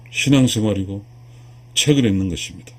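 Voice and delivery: usual speaking voice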